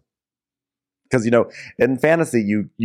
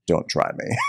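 sleepy whispered threat; 5 semitones lower, 5 dB quieter than usual. Retold or imitated. Sleepy Rhaenys threatening Corlys.

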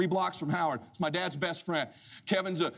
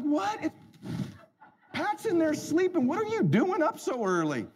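high, incredulous voice; high incredulous questions; baffled father-in-law; 12 semitones higher than usual. Retold or imitated. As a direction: sound thrown and confused. Howard baffled.

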